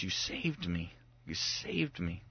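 very soft, low, shaken gratitude; hushed and low; subdued rescued child. Retold or imitated. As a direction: imitate an upset child thanking Dad softly. Upset rescued son speaks to father.

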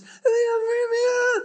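high, strained garbled holler; very high imitation yell; mock distant yell. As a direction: imitate an unintelligible shout from across the road. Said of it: "this guy across the street yelling".

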